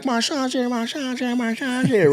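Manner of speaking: high-pitched imitation of buzzing fans; excited crowd. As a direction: mimic a crowd squealing news to each other. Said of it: "everybody's like".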